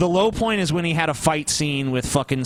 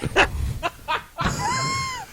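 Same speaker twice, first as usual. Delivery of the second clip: evil cackle. Retold or imitated. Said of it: "he also says".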